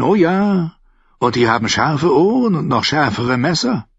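a sly, friendly trader agreeing; Lyseni captain. Acted.

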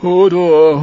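loud, high terrified cry; simple giant wailing in terror; frightened wail. A narrator's reading. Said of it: "Hodor jammerte entsetzt".

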